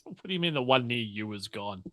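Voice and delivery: normal talking voice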